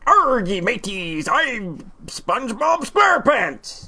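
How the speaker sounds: hammed-up pirate growing slow and high; drawn-out, theatrical pirate caricature. Shown as a demonstration. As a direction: play a cartoonish pirate on demand. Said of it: "give us a pirate voice".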